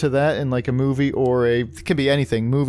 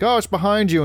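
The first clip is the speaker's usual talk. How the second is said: higher-pitched mock alarm; retold movie warning; 7 semitones higher than usual. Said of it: "they're tracking, like".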